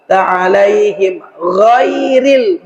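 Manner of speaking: "ghairil" with the gh heavy and slow; thick gha. Shown as a demonstration. Teacher chanting ghairil for students, heavy and slow. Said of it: "tebal gha-nya".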